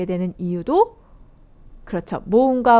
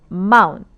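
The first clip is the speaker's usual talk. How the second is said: 마운 said much louder; stressed first syllable; 6 dB louder, slower than usual. Shown as a demonstration. Teacher stressing the first syllable of mountain. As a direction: punch 마운 loudly. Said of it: "여기 있죠".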